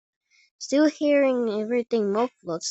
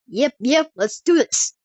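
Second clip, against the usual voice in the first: loud, eager Texan cowboy; Texan cowboy accent; 5 semitones higher, 7 dB louder than usual. Acted. Cowboy sheriff ready to fight, loud Texan accent. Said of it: "in a heavy Texan cowboy accent".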